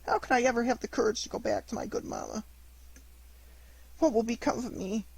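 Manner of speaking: high, tearful pleading; crying in despair. A narrator's reading. Pinocchio crying over his fallen schoolfellow, high and tearful. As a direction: beg him to wake, breaking with grief. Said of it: "crying bitterly in his despair".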